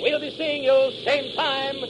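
high, slowed, ringing announcer close; announcer signing off the adventure serial; radio sign-off. Acted. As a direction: sell next week's episode. Show's closing sign-off.

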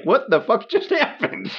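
high-pitched mock bewilderment breaking into laughter; laughing disbelief. Podcaster voices the stunned moviegoer as tank explodes, laughing disbelief. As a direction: crack up while voicing a stunned viewer.

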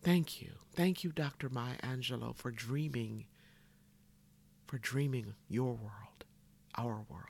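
soft, falling voice of gratitude; hushed tribute. Real, not acted. Speaker thanks the late poet for dreaming, hushed and reverent. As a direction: thank them quietly, letting the words sink away.

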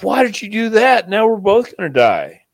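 high, loud anguished frog; frog's dismayed cry. Retold or imitated. Stung frog crying out.

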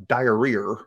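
"diarrhea" ending in an R, like "diarrear"; imitated Southern "diarrhea" with an extra R; added R. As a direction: put a hard R on the end of "diarrhea". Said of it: "put an R in things".

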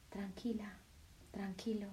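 soothing whispered hush; calm them in a whisper. Acted. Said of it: "tu corazón simplemente te dice". (susurro tranquilizador)

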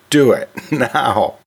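slow, laughing nudge. Real, not acted. Host urging teachers to cut courses, laughing slowly. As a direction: push them to act, giggling.

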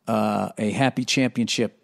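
ordinary talk

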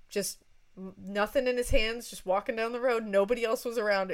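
ordinary talk, nothing marked

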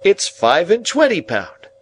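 high, loud, delighted announcement; triumphant rustic reveal. A narrator's reading. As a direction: reveal the sum with glee. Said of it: "delightedly handing the bag".

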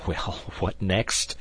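slow question through a helpless laugh; laughing despite himself. A narrator's reading. D'Artagnan hearing a servant was staked, laughing helplessly. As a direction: let laughter leak into the question. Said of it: "laughing in spite of himself".

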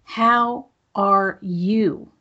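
each word drawn out slowly, caring; a sincere, unhurried how are you; slow, deliberate asking. Shown as a demonstration. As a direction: ask it slowly and mean it. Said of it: "Let me ask you that differently".

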